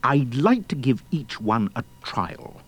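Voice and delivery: low, sly king's voice; shifty smile. A narrator's reading. King eyeing ten thousand would-be brides, low and shifty. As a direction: say it slyly through a shifty smile. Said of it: "said with a shifty smile".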